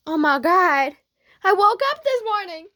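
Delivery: high, loud squealed amazement; excited squeal. Acted. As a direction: shriek it out in delighted disbelief. Repeated as excited outburst.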